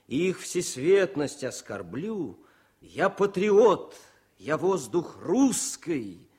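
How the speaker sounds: slow, proud verse reading with weight on each line; poem recital. Acted. Political officer reads his poem to the wounded, slow and proud. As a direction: recite it like a patriot's oath.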